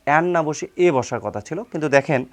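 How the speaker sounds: ordinary talk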